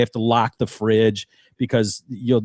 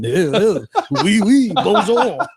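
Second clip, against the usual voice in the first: slow, laughing, higher cartoon French; exaggerated French parody; 6 semitones higher, slower than usual. Acting out the movie-like parody Frenchman, laughing caricature. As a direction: overdo the Frenchman while laughing. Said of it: "fast-paced and exaggerated intonation, a movie-like parody".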